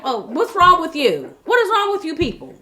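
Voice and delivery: rising, incredulous "what is wrong with you people"; exasperated disbelief. Retold or imitated. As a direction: throw up your hands in disbelief. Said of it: "I'm like".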